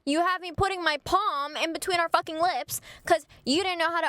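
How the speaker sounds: high-pitched indignant tirade; acted-out fed-up rant; exasperated rant. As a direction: vent in disbelief, pitch riding high. Acted rant about his hidden feelings.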